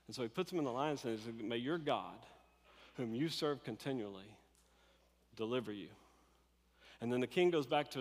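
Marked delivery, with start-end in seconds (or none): 1.3-5.9 the same blessing said low and soft; a hushed, heavy-hearted send-off into the den; hushed farewell. Retold or imitated. King quietly blesses Daniel at the den, subdued.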